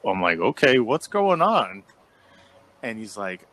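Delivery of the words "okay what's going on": urgent demand. Retold or imitated. Hearing screaming in the room.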